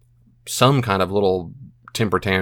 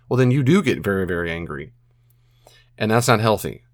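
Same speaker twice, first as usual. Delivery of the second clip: ordinary delivery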